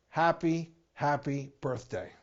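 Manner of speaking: ordinary delivery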